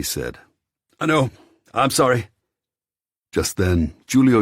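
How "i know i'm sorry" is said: calling out. Acted. Answers landlady across distance.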